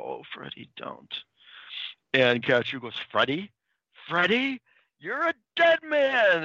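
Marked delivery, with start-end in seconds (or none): 0.0-1.1 dazed mumble. Retold or imitated. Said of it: "hear Francine just mumble". 3.1-6.4 questioning, building to a high, drawn-out mock threat; mock enraged threat. Retold enraged character's threat, building.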